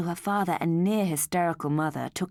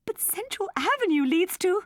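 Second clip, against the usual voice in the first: alarmed; 14 semitones higher, 5 dB louder than usual. Acted. Parent realises the danger.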